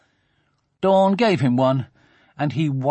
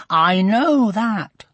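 slow, pressed mock growl, a bit louder; 7 semitones higher, 4 dB louder, much slower than usual. A narrator's reading. Sophie snapping back at Dawn, a slow hammed-up growl. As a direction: grind the words out slowly, irritated. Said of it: "growled Sophie".

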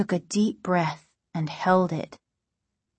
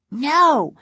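insistent; 6 semitones higher, 4 dB louder than usual. A narrator's reading. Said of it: "Casey insisted".

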